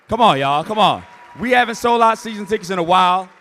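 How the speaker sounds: loud rallying call; hyped shout. Real, not acted. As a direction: rouse the crowd. Rallying applause from crowd.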